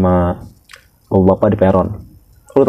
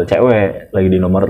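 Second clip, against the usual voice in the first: usual speaking voice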